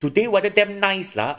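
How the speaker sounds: high, loud sarcastic Manglish; sarcastic Malaysian. Shown as a demonstration. Bus is late, sarcastic Malaysian complaint, high and loud. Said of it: "you can say".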